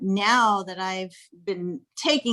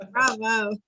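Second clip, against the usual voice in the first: ordinary delivery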